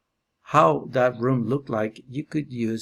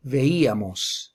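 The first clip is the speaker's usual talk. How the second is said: veíamos slow, leaning on the í of veíamos; stressed accent; slower than usual. Shown as a demonstration. Teacher showing students the nosotros accent, slow stressed í. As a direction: draw out veíamos with weight on í. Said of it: "an accent on the nosotros form".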